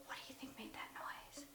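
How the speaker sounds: very soft, spooked-sounding whisper; hushed whisper. Retold or imitated. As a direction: whisper it after an eerie noise. Hushed talk after eerie howl.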